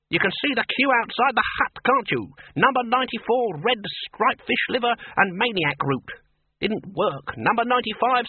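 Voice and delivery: high-pitched, sarcastic Senior Wrangler rant; mock the idea, piling up failed attempts in a high voice; nasty sarcasm. A narrator's reading. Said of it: "said the senior wrangler nastily".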